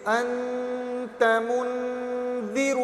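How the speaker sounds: "انت منذی" stretched very slowly; slow blending. Shown as a demonstration. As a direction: sound out "انت منذی" slowly, every syllable drawn. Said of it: "اسے پانچ مرتبہ کہہ لیں".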